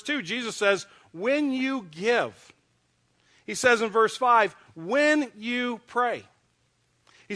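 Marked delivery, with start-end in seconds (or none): 1.1-2.5 very slow, every word stretched; slow emphatic quote. Acted. Pastor quoting Jesus to the congregation, slow emphatic. 4.8-6.2 very slow, every word stretched; slow emphatic quote. Acted. Pastor quoting Jesus to the congregation, slow emphatic.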